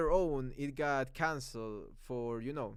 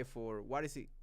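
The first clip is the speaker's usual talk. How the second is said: ordinary talk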